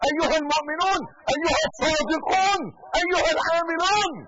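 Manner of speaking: loud, high, rising proclamation; fiery preaching. Acted. Preacher proclaiming the truth to believers, loud. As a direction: drive the truth home to the faithful. Sermon climax at full force.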